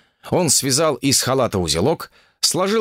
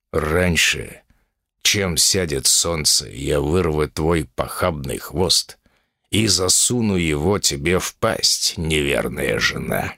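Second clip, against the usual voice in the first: deep, slow threatening growl; menacing bull demon; 7 semitones lower, slower than usual. Acted.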